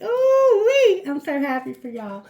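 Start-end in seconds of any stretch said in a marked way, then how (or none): 0.0-1.0 much higher, much louder and slowed; delighted whoop. Real, not acted. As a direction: let out a joyful drawn-out whoop. Delighted by abundance reading.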